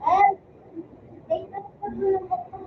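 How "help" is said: loud, sudden cry for help; call for help. Real, not acted. Player calling teammates for rescue, loud call for help. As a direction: call out loudly to your teammates.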